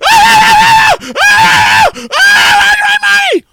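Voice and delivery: repeated high raw screams; furious scream. Real, not acted. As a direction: scream with rage. Screams after angry outburst.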